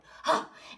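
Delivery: sharp intake of breath; shocked gasp. Acted. Horse spots the snail ahead, shocked gasp. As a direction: gasp in disbelief.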